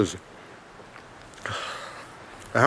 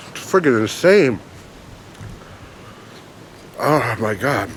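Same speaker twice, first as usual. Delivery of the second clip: pepper pain; 5 dB quieter than usual. Real, not acted. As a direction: mouth on fire, sighing. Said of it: "They're still burning right now".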